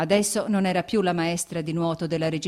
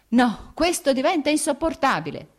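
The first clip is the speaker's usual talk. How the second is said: higher, louder outburst of exasperation; angry shout; 9 semitones higher, 6 dB louder than usual. A narrator's reading. Vronsky jumping up from his chair at Anna, shouting. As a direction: cry out that it's unbearable. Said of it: "gridò Vronsky".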